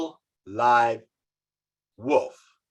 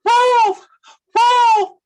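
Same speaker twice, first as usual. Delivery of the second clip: calling out; 11 dB louder than usual. Acted. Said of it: "calling out".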